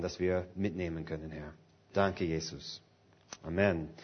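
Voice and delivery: quiet prayer. Real, not acted. Preacher praying over the sermon, soft and hushed. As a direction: pray gently and quietly.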